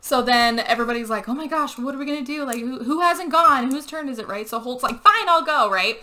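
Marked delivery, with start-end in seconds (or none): 1.3-4.3 higher-pitched mock fretting; flustered group worry, acted out; anxious questions, pitched up; retold panic. Group panics over next turn. 5.0-5.7 high, loud retold giving-in; huffy mock agreement; exasperated volunteering. Holt reluctantly volunteers for turn.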